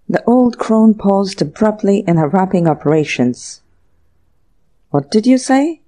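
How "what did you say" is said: taken aback. A narrator's reading. Startled shopkeeper stops wrapping.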